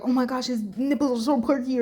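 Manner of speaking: lightly mocking thirsty fan; mock fan gush. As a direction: mimic a swooning fan.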